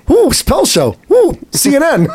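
high, excited little oohs; mock thrill. Mocking the news cycle with high mock excitement. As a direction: gasp at the headlines like gossip. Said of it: "It was".

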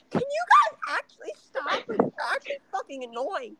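shrieky falsetto mimicry of an annoyed girl; hammed-up high-pitched complaint; Olivia Glenn. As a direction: squeal it in a very high, whiny mock voice. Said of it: "and she turns around and goes".

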